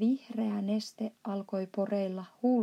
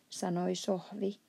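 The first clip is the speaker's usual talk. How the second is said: ordinary delivery, nothing marked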